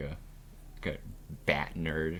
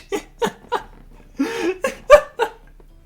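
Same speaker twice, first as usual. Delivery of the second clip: high shrieking laugh; loud cackle; 12 dB louder than usual. Real, not acted. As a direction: burst out cackling. Laughing after impression.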